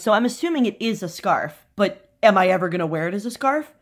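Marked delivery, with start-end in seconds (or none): none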